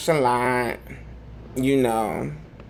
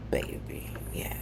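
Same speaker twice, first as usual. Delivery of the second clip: whisper; 13 semitones lower, 13 dB quieter than usual. Real, not acted. Whispered aside.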